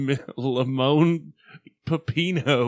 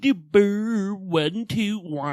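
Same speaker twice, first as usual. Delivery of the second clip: louder, higher gibberish countdown; higher, louder mock count; 6 semitones higher, 8 dB louder, slower than usual. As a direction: ham up the tongue-tied count, louder. Mocking his countdown in gibberish.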